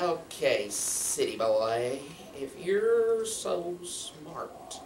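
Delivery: very slow, drawn-out dare; challenging local boy. Acted. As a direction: needle him with stretched-out words. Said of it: "one of them said".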